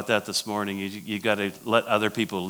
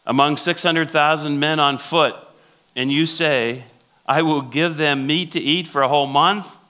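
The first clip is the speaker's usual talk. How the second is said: scoffing disbelief, pitched up and loud; incredulous Moses; 5 semitones higher, 4 dB louder than usual. Acted. Moses doubting God's promise of meat, scoffing. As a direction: read it as if laughing at the promise. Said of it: "Moses is laughing at God".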